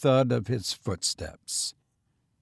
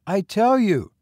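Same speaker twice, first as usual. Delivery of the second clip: put-on high, insistent boy, hoarseness only suggested; hoarse boy; 9 semitones higher, 6 dB louder than usual. A narrator's reading. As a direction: insist as a young lad, pitched up. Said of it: "the boy said hoarsely".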